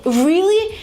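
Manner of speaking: high, rising surprise; astonished question. Acted. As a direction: ask them to confirm the big news. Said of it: "They just ask you again".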